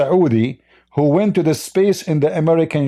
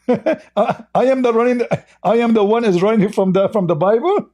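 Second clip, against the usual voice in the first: laughing at him while talking, higher; mocking laughter; 6 semitones higher than usual. Real, not acted. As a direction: scoff through a laugh. Laughs at opponent's claim.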